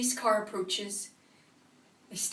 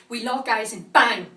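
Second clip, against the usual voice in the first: much louder, punching out the last word; explosive outburst; 11 dB louder than usual. Acted. Attacker reliving the moment eyes lock, explosive. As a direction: burst out loudly on the impact. Acted violent climax of monologue.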